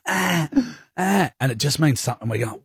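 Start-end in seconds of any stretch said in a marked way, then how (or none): 0.1-1.3 high mock baby wail; lightly imitated baby cries; imitating baby crying. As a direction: cry out like a fussing newborn. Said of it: "So he's just going".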